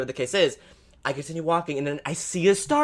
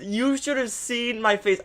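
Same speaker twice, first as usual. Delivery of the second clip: ordinary talk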